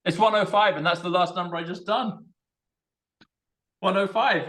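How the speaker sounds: high-pitched, excited realization; excited astonishment. Real, not acted. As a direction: exclaim at an amazing coincidence. Amazed the number recurs.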